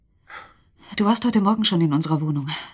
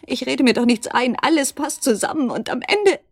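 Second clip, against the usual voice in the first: aufgewühlt; 6 semitones higher, 4 dB louder than usual. Acted. Verzweifelte Frau am Bett.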